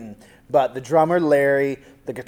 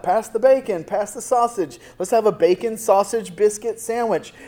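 higher, cheerful table banter; playing Peter having a great time, pitched up; jolly Peter at table; 6 semitones higher than usual.

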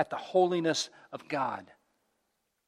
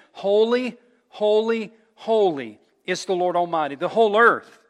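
loud, ringing proclamation of holiness; calling out; 4 semitones higher, 8 dB louder than usual. Acted. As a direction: call it out loud, word by word. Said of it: "they were calling to one another".

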